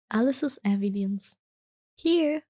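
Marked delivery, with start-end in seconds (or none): 2.0-2.4 cried. A narrator's reading. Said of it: "cried Alice".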